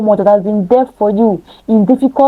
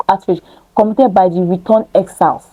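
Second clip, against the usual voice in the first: normal talking voice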